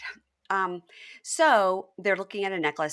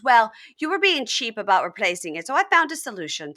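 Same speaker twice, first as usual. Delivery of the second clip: high, loud retold retort; imitated defiant comeback; 5 semitones higher, 7 dB louder than usual. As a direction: throw the blame back, hurrying at the end. Retelling her angry comeback.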